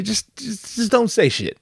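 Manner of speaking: Bambi's mother. Retold or imitated. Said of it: "what does Bambi's mother say".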